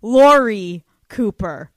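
loud announcer build, slowing onto the name; big guest introduction. Real, not acted. Daughter introducing her mother to listeners, big announcer introduction.

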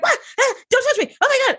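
high-pitched mock panic; imitating a terrified crewmate; panicked. Panicked character freaking out.

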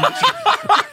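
breaking into high laughter; laughing. Real, not acted. Reading out her friend's botched email, collapsing into laughter. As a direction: laugh through the last words. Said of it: "laughing at reading her own email".

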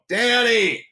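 put-on high, drawn-out call for a kid; mother hollering a child's name. Neighbor mother calls her son Danny, calling out.

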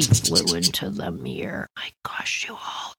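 hushed, breathy whisper of shock. Real, not acted. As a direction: whisper it as if the crash just happened. Bird crashes into mirror.

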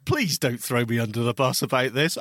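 normal talking voice

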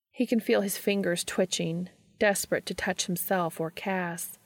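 ordinary delivery

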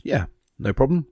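high, breezy genie granting a wish. Retold or imitated. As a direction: toss it off like a carefree genie. Genie in a joke.

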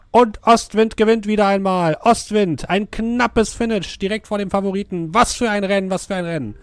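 booming excited racetrack announcer; race caller. Acted. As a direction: call the finish like a race commentator. (begeisterter Rennkommentator)

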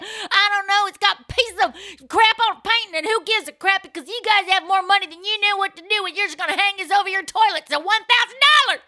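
high, loud, screaming auctioneer; angry auctioneer. Pretend. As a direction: yell at the rich bidders. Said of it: "she'd actually be a good auctioneer".

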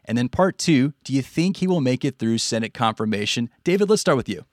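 ordinary talk, nothing marked

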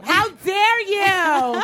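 high, slow, stretched-out scolding; escalated outrage. Retold or imitated. Imitating girlfriends scolding a drunk man, high drawn-out outrage. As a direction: drag out each word in mock fury. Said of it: "they were just swearing".

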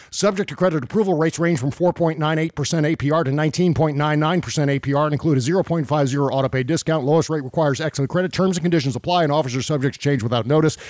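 rattled-off fine print, fast; fast legal disclaimer. Acted. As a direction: rush through the terms quickly. Rapid ad disclaimer.

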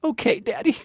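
humoring daughter; daughter humoring dad. Acted. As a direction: go along sweetly like a good daughter. Daughter answering dad hologram.

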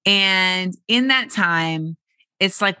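ordinary talk

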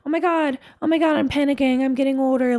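mock panic. Imitating her own anxious self panicking about aging. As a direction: play the anxious inner voice freaking out about age.

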